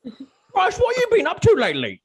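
a friend loudly teasing. Acted. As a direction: rib him loudly and quickly. Teasing another player, loud.

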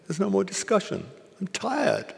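high-pitched, mock exasperated refusal; imitated dismissive master. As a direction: shut the conversation down, voice pitched well up. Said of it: "He's like".

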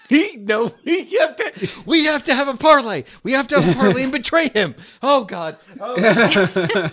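high, loud, frantic pleading with laughter; comic panic. Acted. Dwarf begging friends to parley before they die, mock panicked.

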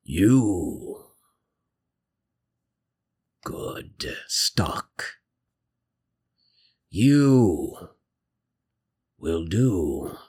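slow, low, dark creature voice; ominous dark voice. Acted. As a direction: intone it word by word, with long pauses. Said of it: "not to be so ominous".

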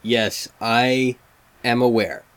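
usual speaking voice

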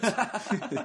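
high, loud burst of laughter. Real, not acted. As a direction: crack up at the joke. Laughs at own punchline.

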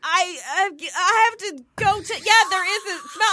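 high, halting stammer; mock flustered. Passenger reacting to the smell, mock flustered. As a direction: imitate her faltering, pitch held way up.

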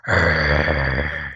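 very low roar starting on a U; deep throaty growl; guttural ugh roar. Shown as a demonstration. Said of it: "leading with a U and not an R".

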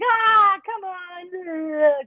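high, cajoling mock pleading; goading. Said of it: "goading her into it".

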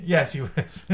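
unvoiced whisper back. Answering the whisper joke in a whisper.

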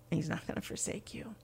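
soft whispered reassurance; husband soothing his crying wife; whispered comfort. Retold or imitated. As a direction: whisper it like a secret promise. Husband comforting her, quoted.

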